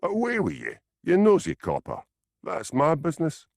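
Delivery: defiant Glaswegian dismissal, pitched high; sharp, high defiant brush-off. A narrator's reading. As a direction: shoo away the nosy copper. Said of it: "She stared at him defiantly".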